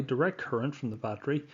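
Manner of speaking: ordinary delivery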